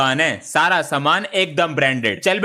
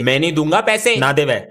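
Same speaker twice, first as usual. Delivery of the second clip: ordinary delivery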